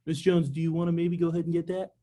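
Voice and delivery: mock unsure question rising at the end; a hammed-up wishy-washy salesperson asking; weak timid ask. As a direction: imitate a hesitant rep who expects no. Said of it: "a lot easier to say yes to than".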